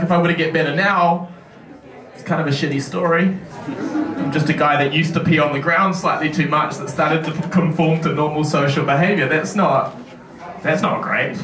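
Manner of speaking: ordinary talk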